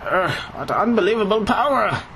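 lightly voiced old nerd, high; a hint of a nerdy old man. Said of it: "go with the typical old nerd voice".

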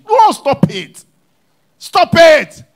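loud, high, slow rebuke; forceful preacher's rebuke; stern rebuke. Acted. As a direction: command them to stop. Rebuking congregation.

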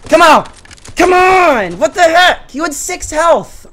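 high, loud protest; real frustrated gamer outburst; exasperated shouting. Real, not acted. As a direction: yell in disbelief at an unfair death. Killed despite landing shots.

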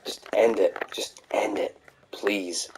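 unvoiced pleading whisper; whispered plea. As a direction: beg it softly under your breath. Begging the series to end.

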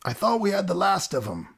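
high, lightly voiced second crewman; a touch of surprise from another voice; another passenger. Second passenger answering on the ship, lightly voiced and high. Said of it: "came another voice".

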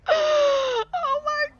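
high, loud mock scream; hammed-up scream. Player yelling as car hits him, high and loud. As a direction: scream like you're falling.

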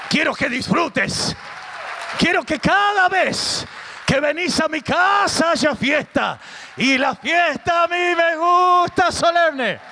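high preacher's shout, building; exultant proclamation. Retold or imitated. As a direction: cry it out high with drawn-out key words. God's wish builds into exultant cry. (proclamación exultante a gritos, con palabras alargadas)

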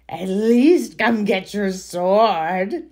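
slow, drawn-out coaxing creature; troll voice. Acted. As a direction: tempt them closer like a cunning troll. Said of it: "Your troll voice is on point".

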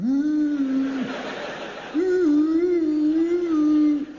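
silly loud ooh calls; hooting out the window. Retold or imitated. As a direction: hoot like a daft drunk at the glass. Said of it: "just going".

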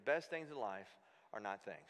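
soft and low, trailing to a murmur; quiet echo. Shown as a demonstration. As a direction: say it again under your breath. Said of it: "How many of you guys believe that?".

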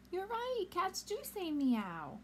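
usual speaking voice

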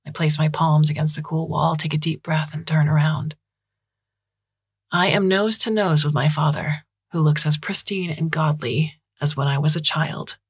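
usual speaking voice

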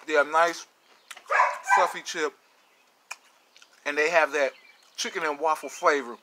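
speaking between bites; chewing while talking. Real, not acted. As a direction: mouth partly full. Seen 2: Chip bitten at -2; pursed, working lips until about 6.3.

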